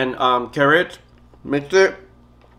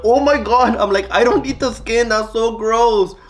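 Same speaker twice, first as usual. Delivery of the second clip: shocked; 8 semitones higher than usual. Retold or imitated. Retells her shocked reply to boyfriend.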